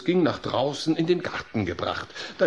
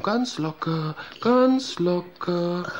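soothing coaching; 3 semitones higher, slower than usual. Acted. Relaxation coaching.

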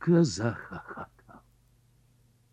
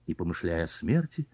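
ordinary delivery

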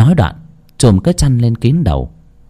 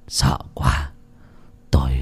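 much softer, whispered fright; breathless whisper; 9 semitones lower, 8 dB quieter than usual. A narrator's reading. Shaken husband whispering his fear to his wife. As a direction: gasp it out terrified. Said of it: "mà hổn hển".

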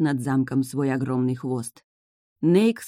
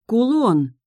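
raised, louder startled exclamation; gasped realisation; 7 semitones higher, 5 dB louder than usual. A narrator's reading. Heroine suddenly remembering the stolen pendant, gasped realisation. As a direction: blurt it as it dawns on you. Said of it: "ахнула я".

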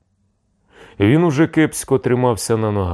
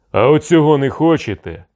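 raised, louder jeering question; defiant taunt; 8 semitones higher, 5 dB louder than usual. A narrator's reading. Cossack showing the envoys a rude gesture, defiant taunt. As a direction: throw it at them as a rude challenge.

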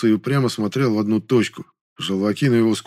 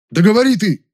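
much higher, loud indignant outburst; 12 semitones higher, 6 dB louder than usual. A narrator's reading. Man urges stalling friend to speak, indignant. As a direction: burst out, demanding that he talk. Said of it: "возмутился я".

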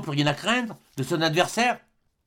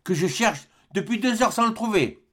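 high-pitched exclamation; crying out; 7 semitones higher than usual. A narrator's reading. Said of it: "s'écria le capitaine". (en s'écriant, voix haute)

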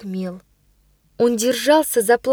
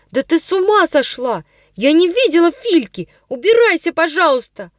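high, louder, pushing her away; agitated shouting; 6 semitones higher, 4 dB louder than usual. A narrator's reading. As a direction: shout her off, shaken and indignant. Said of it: "вскричала она вся бледная и взволнованная". (взволнованный крик)

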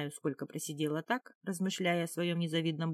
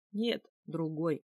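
slow, lightly amused denial; wry chuckle; slower than usual. A narrator's reading. Captive correcting a question about Хальгард, wryly amused. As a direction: let it out slowly with a touch of a smirk. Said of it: "усмехнулась".

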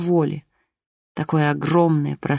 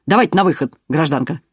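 loud curt order; commanding; 4 dB louder than usual. A narrator's reading. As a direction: command her out. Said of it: "скомандовал".

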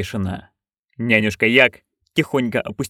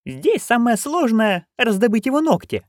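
put-on higher, eager old crone; Nanny Ogg voice; 12 semitones higher than usual. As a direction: pitch up into a gleeful old busybody. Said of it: "распалялась нянюшка". (высокий азартный голос старой ведьмы)

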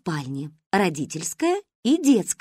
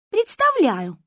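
higher, grumpy retort; grumbling; 10 semitones higher, slower than usual. A narrator's reading. Manka grumbles back at her friend, higher and grumpy. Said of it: "проворчала Манька".

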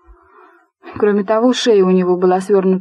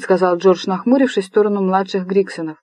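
ordinary delivery

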